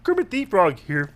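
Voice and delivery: puppet frog announcing himself; Kermit the Frog. Shown as a demonstration. Showing friend how voices morph, puppet frog voice. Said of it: "change it just a little bit to".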